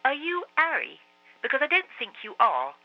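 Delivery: put-on earnest young boy asking; boy's voice. A narrator's reading. Marcus questioning the frog.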